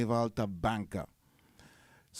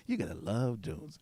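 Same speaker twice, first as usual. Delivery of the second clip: softer put-on American accent; 2 semitones lower, 5 dB quieter than usual. Repeating the American friend's phrase, in an American accent. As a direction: mimic the American way of saying it. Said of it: "American accent".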